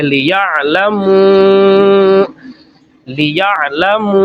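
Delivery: لیعلمو with the long mu held very slowly; held madd on لیعلمو; slow madd demo. Shown as a demonstration. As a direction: stretch the final مو in لیعلمو. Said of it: "اس کو ہم نے اونچا کر کے پڑھنا ہے".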